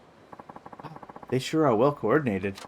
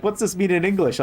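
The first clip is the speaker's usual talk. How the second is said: higher, louder imitation of a puzzled tourist; mock passenger; 7 semitones higher, 4 dB louder than usual. Streamer mimicking the foreign passenger, higher and louder. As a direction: mimic them asking, pitched up. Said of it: "they're like".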